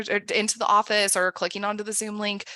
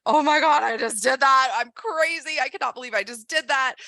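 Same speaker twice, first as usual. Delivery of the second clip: excited; 4 semitones higher, 5 dB louder than usual. Pretend. Thrilled after sending the letter.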